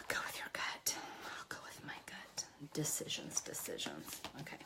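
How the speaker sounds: whispering to herself; hushed whisper under breath. Real, not acted. Deciding, talking to herself.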